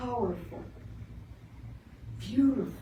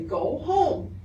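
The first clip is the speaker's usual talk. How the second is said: firm, loud command to a dog; speaking up loud and clear to a strange dog; 8 semitones higher, 5 dB louder than usual. Shown as a demonstration. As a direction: tell the stray firmly to go home. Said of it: "speak loudly and clearly".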